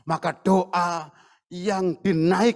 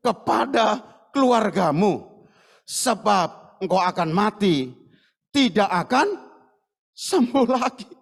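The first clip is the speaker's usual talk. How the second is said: slow solemn reading. Acted. Reading the prophet's message slowly.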